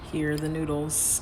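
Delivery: ordinary delivery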